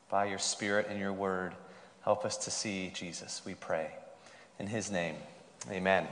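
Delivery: soft, low-pitched prayer; hushed, low voice leading prayer. Real, not acted. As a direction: pray softly and reverently for the congregation. Leads an opening prayer.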